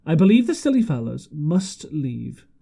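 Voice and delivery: ordinary delivery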